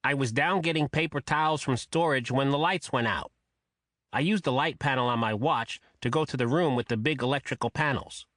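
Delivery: high, quacking nasal voice. A narrator's reading. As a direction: honk it through the nose like a duck. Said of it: "saying in that nasal quacking voice".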